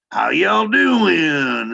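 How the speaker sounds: high, loud, long drawn-out Texan holler; cowboy Texan accent. Shown as a demonstration. Actor demonstrates Punch switching back, in cowboy Texan accent. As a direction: stretch it into a big cowboy Texan drawl. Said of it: "then I go right to cowboy again".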